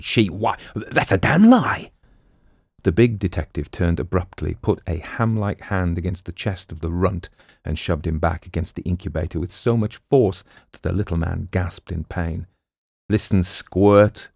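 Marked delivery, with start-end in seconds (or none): 0.0-1.9 stammered start rising to a high, slow protest; splutter, then burst out indignantly; spluttering outrage. A narrator's reading. Little man denying the girl's accusation, high spluttering indignation. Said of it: "The bantam spluttered". 13.1-14.3 slow, lightly voiced tough cop; stern detective. Detective warning the little man, slow and mildly stern.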